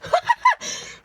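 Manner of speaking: squealing laugh; high burst of laughter. Real, not acted. Laughing at own attempt.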